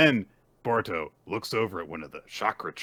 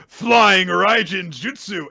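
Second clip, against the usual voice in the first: loud, higher, slow technique call; calling a jutsu; 10 semitones higher, 11 dB louder, slower than usual. Retold or imitated. Boruto announcing his teleport technique, slow loud call. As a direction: shout the move name like an anime hero. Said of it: "Boruto goes".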